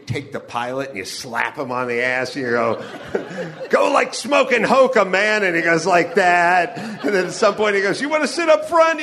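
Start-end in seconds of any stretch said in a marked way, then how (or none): 3.7-5.4 raised, loud buddy-to-buddy holler; boisterous mock passenger; rowdy greeting. Acted quote, slapping the pilot. 8.0-9.0 high, loud acted offer; pitched-up, thrilled mock pilot; excited invitation. Acted pilot's invitation on private plane.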